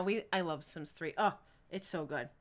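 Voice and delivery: ordinary talk, nothing marked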